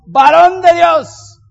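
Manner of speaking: loud, high, slow cry of alarm; the sons of the prophets crying to the man of God, loud slow alarm; desperate shout. A narrator's reading. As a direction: cry out in panic that the food is poisoned. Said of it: "gritaron diciendo".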